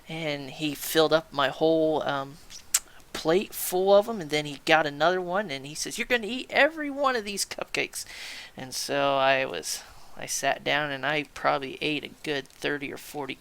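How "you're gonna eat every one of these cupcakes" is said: high-pitched sergeant's order, not truly shouted; yelling sergeant. Retold or imitated. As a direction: imitate a sergeant laying down punishment. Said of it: "Gunnery Sergeant yelling".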